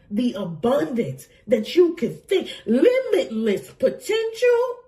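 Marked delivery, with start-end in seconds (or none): 2.7-4.8 high and slow, laughing through it; gleeful, laughing read-out; laughing delight. Real, not acted. To her viewers over the card: laughing, high delight.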